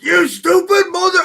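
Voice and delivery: high mock yell at a stupid mother; imitating Mud yelling. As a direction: shout it up in a hammed imitation. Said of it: "he goes".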